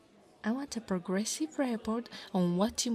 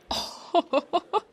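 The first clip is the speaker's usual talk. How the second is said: high, loud cackle; short bursts of mocking laughter; gloating laugh; 8 semitones higher, 6 dB louder than usual. Gloating over nerdy sticky notes.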